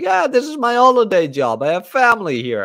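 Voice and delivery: high, bright-pitched salesman voice; cheerful salesman. Retold or imitated. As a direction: chirp it like the happy vendor. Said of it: "He's like".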